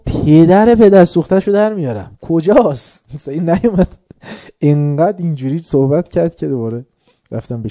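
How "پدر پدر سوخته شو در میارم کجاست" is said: loud, high furious threat; furious shout. Retold or imitated. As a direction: roar the threat at the messenger. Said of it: "داد زد، گفت". (تهدید با فریاد)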